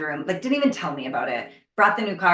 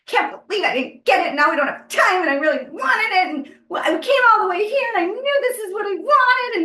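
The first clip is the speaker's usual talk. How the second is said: put-on high, whiny little-kid complaining, getting louder; childish whine; 13 semitones higher, 4 dB louder than usual. To her mom in New York, a high childish tantrum over the bag. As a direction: whine it like a child denied a toy. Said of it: "I was acting like a child".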